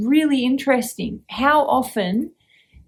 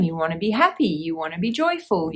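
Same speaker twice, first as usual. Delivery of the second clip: ordinary talk, nothing marked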